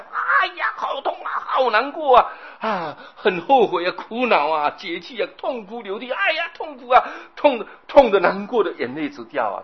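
hammed-up high, loud cries of pain; agonized wailing. Preacher acting out hell's sufferers for listeners, agonized wailing. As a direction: wail and moan like a tormented soul crying out. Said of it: "再加上我的表演啊".

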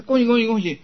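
high, loud mock greeting; imitated congratulations. Teacher imitates people offering congratulations, high and loud. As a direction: call out congratulations, imitating a well-wisher. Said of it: "说，你说".

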